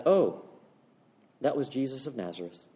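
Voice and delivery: casual, softly tossed-off answer; nonchalant. Acted. Man in the pew answering the pastor, nonchalantly. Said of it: "this man nonchalantly said".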